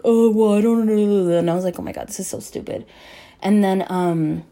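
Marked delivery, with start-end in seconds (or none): 0.0-1.4 slurred, mumbled drunk imitation; higher mock drunken babble; drunk mess. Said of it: "he was drunk and he was just, like, a mess". 1.8-2.8 muttered exasperation. Retold or imitated. Her exasperated aside in retold fight.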